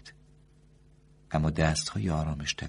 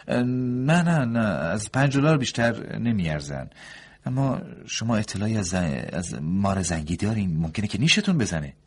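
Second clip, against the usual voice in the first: higher, louder doctor, protesting; doctor's voice; 7 semitones higher, 7 dB louder than usual. A narrator's reading. Doctor sets the price.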